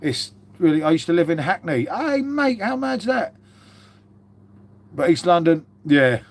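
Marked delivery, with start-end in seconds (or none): 1.8-3.3 much higher, jokey "how mad's that"; high mock surprise. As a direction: pop up high as if amazed at yourself. Mock exclaiming at own Hackney past.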